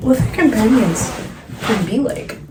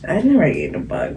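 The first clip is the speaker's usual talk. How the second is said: ordinary delivery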